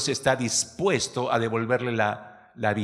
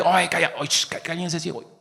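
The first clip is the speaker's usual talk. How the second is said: shushing crowd; 6 semitones higher than usual. Pretend. Hypocritical crowd hushing blind man.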